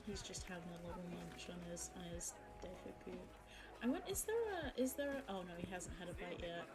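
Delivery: usual speaking voice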